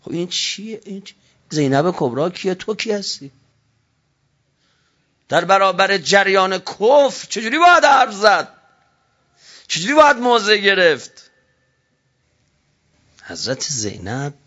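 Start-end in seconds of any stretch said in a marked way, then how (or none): 5.3-11.2 impassioned preaching. Acted. Rhetorical preaching.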